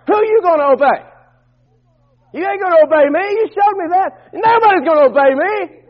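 high, forceful pulpit voice; punchy, high-pitched preacher; preaching challenge. Acted.

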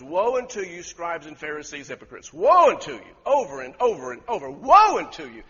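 loud, forceful cry of woe; thundering woe. Retold or imitated. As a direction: hurl it like an angry lion. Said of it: "And he's not whispering".

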